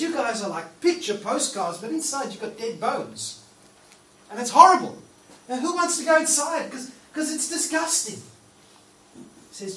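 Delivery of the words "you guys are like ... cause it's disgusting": Jesus scolding. Retold or imitated. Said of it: "Jesus looks at them and goes".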